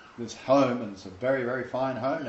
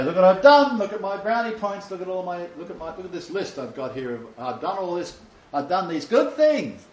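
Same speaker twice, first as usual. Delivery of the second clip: higher-pitched show-off, loud at first; acted self-congratulation, pitched up; mock boasting; 6 semitones higher, 5 dB louder than usual. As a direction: reel off your merits like an eager boaster. Acts believer boasting before the Lord.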